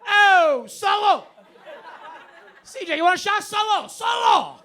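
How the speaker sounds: loud, high hammed-up Italian cheer; toasting the whole bar in mock Italian. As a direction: shout salute like an over-eager Italian host. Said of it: "They're like".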